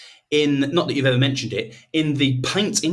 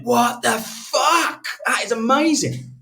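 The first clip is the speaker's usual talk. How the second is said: stunned amazement; 9 semitones higher, slower than usual. Retold or imitated. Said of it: "I went".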